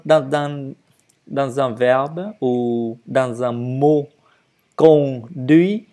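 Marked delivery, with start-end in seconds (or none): 4.8-5.9 both syllables of "conduit" given equal weight; "conduit" firmly, with neither syllable favoured; no word stress. Shown as a demonstration. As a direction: say "conduit" in two even, separated syllables. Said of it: "il n'y a pas d'accent".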